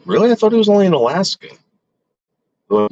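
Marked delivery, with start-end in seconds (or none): 0.0-1.5 high, fast mock disbelief; retold disbelief. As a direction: squeak it out, half-joking shock. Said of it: "I was like".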